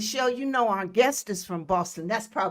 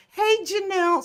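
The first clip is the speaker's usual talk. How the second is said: excited greeting; 10 semitones higher, 6 dB louder than usual. Real, not acted. Greets sister again.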